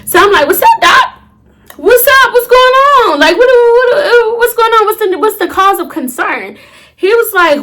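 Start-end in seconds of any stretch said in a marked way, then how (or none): 0.5-6.6 a scared patient begging the doctor for answers; panicked pleading. Retold or imitated. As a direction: voice cracking with panic.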